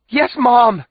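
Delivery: high, loud eager shout; calling out. A narrator's reading. Daughter answering her mother in the dark, calling out. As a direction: call loudly across the distance. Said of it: "Wisty calls into the dark".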